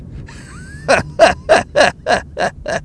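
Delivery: staccato burst of laughter; helpless chuckle at your own story; real laugh. Real, not acted. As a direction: laugh in short, separate pulses.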